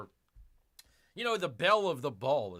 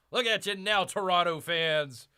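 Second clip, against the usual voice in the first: louder, higher repeat to gloat; gloating taunt; 4 semitones higher, 6 dB louder than usual. Real, not acted. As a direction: rub it in their faces. Taunting rival hockey fans.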